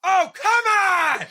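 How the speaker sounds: high, drawn-out exasperated shout; hammed-up groaning fan; mock yell at the screen. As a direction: wail your disbelief at the screen. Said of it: "I was just yelling at the screen".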